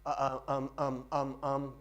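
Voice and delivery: monotone string of hesitation noises; mock filler ums. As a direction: imitate a speaker filling every gap with ums. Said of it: "You don't hear me say".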